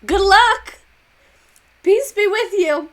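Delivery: high, loud mock blessing of a president; hammed-up, carefree politician voice; loud sing-song brush-off of a president; mocking Hoover. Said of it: "He said".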